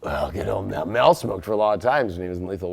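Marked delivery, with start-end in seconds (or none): none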